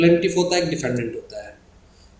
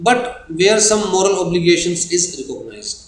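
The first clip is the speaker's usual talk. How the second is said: slow dictation; much slower than usual. Shown as a demonstration. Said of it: "reads definition slowly".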